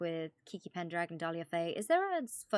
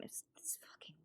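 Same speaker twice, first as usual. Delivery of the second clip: whispered aside; 19 dB quieter than usual. Real, not acted. Aside about the phone call.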